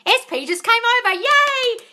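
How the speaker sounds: high, loud happy read-out; gleeful yay; excited reading. Retold or imitated. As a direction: share the good news with glee.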